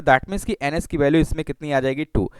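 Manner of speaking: usual speaking voice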